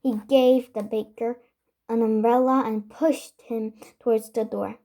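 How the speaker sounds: ordinary delivery, nothing marked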